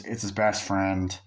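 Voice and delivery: ordinary talk, nothing marked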